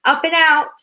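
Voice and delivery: high, loud triumphant cry; gleeful Wonka. A narrator's reading. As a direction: launch with glee.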